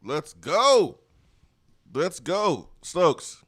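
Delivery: loud, high rallying call; hype shout. Real, not acted. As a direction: whoop it up to fire up your co-host. Hype call to co-host Stokes.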